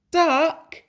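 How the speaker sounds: high, loud call of a name. A narrator's reading. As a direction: call Duck's name ahead. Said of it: "he called".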